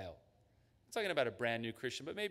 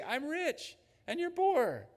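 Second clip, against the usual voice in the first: mocking, much higher boast; smug boast; 14 semitones higher, 5 dB louder, slower than usual. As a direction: parody the proud churchgoer bragging. Voicing the smug listener.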